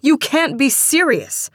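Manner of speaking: loud, high outraged disbelief; angry shout. A narrator's reading. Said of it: "Marcia shouted".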